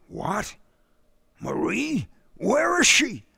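rising high, startled questions; shocked questions. Acted. Buckhammer asks the father in shock where Marie is. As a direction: ask in disbelief.

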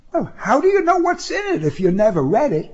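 a hint of a young woman; woman's voice. A narrator's reading. Woman's line in argument.

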